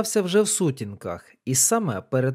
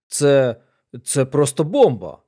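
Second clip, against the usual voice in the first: loud, stunned exclamation; exclaiming; 2 semitones lower, 4 dB louder than usual. A narrator's reading. Colonel reacts to the coded telegram, exclaiming. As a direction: burst out in astonishment, voice raised. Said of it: "вигукнув полковник".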